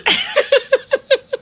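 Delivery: shrill mocking cackle; high laugh. Real, not acted. Taunting the reporter with a high laugh. After nickname joke.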